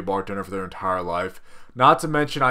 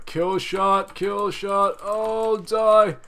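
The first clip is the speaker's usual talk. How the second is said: excited; 8 semitones higher, 4 dB louder, much slower than usual. Real, not acted. Finishing off the enemy.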